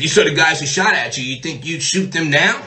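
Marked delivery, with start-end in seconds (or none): none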